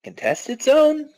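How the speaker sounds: high, slow alarmed question; shocked. Acted. Hero alarmed at the news, high and slow. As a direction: echo the words in alarm. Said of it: "shocked question".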